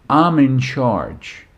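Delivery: "I'm in charge" slowly, with weight on "I'm"; measured echo, stressing "I'm"; emphatic echo. Retold or imitated. Dad echoes "I'm in charge" about himself, slow and emphatic.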